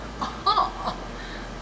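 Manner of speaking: high airy burst of laughter; giggling fit at herself; high breathless laugh. Real, not acted. Laughing at her own silly voice.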